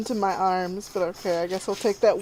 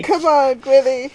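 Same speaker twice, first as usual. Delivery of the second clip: raised, higher call to a pet; calling the cat; 3 semitones higher, 7 dB louder than usual. Acted.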